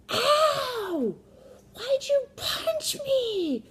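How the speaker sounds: very high, loud, drawn-out cry of pain; hammed-up wail. Son hit in the arm by his sister, mock wailing. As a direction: wail it like an outraged punched kid.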